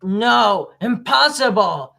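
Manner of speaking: loud, shocked denial; a loud cry of shocked disbelief; triggered outburst. Retold or imitated. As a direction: blurt it out in disbelief. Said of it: "Spy is triggered".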